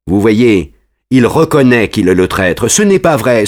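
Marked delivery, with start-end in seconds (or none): 2.7-3.5 "Ce n'est pas vrai" higher, anxious but held; first "Ce n'est pas vrai" a touch shaky; nervous denial. Acted. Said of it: "en rougissant et en tremblant".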